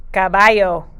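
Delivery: usual speaking voice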